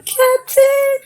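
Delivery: high, squealed tearful cry; hammed-up happy wail; tearful joy. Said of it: "she's crying tears of joy".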